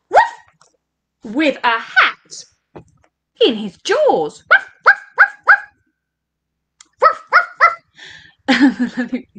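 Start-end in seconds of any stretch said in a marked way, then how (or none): 0.1-0.3 short mimicked woof; dog bark. A narrator's reading. Storyteller playing the dog for children, a quick bark. 4.5-9.3 high, short mimicked woofs; dog barks. A narrator's reading. Storyteller playing the dog for children, high yapping barks.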